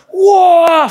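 high, loud, laughing whoa; excited whoa. Acted. Pastor picturing his son flying over the pool, a loud laughing whoa. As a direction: burst out in delighted amazement.

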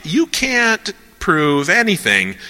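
high, slow dismissive claim; skeptic's challenge. Acted. Voicing skepticism for the audience, high slow challenge. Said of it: "skepticism, which is like".

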